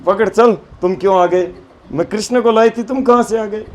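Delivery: loud scolding march-off. Acted. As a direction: drag him off, scolding loudly. Gopi scolds her son again. (ज़ोर से डाँटते हुए)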